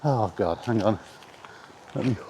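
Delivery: usual speaking voice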